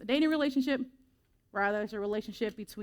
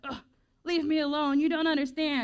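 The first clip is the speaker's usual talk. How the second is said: put-on groaning, higher and louder, like a fed-up teen; mock teenage tantrum, raised and exasperated; whiny teen outburst; 5 semitones higher, 4 dB louder than usual. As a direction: huff it out as a sulky kid shutting the door. Acted teen slamming door on parents.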